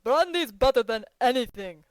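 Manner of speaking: loud booming advice. A narrator's reading. Yashvin booms his advice to Petritsky. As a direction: boom it out for the whole room. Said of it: "boomed Yashvin".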